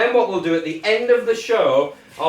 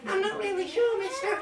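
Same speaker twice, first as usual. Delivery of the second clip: put-on childlike voice; 13 semitones higher, 7 dB quieter than usual. Teacher voices unsure child.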